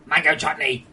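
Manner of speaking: loud, proud food announcement; loud reveal. Real, not acted. Streamer revealing a massive jar to viewers, loud announcement. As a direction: announce it loudly like a big reveal.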